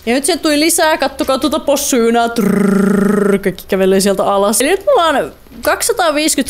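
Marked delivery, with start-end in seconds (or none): 2.3-3.4 rolling trilled r like a trotting pig; playful trilled sound effect; rolled trr noise. Pretend.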